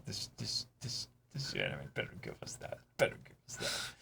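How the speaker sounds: low, hushed threatening whisper; a mock threat, whispered low; menacing whisper. Real, not acted. Threatening demand to the anime.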